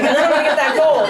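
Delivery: fast, loud greedy glee; eager villain plotting, fast and loud; gleeful scheming. Acted. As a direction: rush it out like a gloating schemer. Greg scheming about gold.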